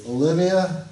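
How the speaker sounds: loud roll-call shout with rising pitch. Real, not acted. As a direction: call the name out across a classroom. Calling the roll in class.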